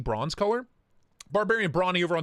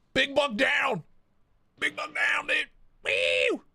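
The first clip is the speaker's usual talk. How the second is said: mock hunter shout; 10 semitones higher, slower than usual. Showing Isaiah the knife like a hunting trophy, shouting. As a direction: holler it like a hunter bagging a deer.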